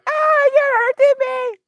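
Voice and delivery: very high, squeaky put-on voice; squeaky pet voice. Voicing the pet crying out to its owner, squeaky and high. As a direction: squeak it like a tiny hurt creature.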